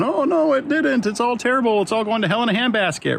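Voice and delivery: high mock wail of doom, easing down; hammed-up hand-wringing; despairing voter. To listeners whose side lost the election, a high mock wail of despair. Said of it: "you might be going".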